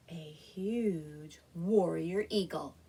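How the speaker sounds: low, slow storyteller awe; majestic reveal. A narrator's reading.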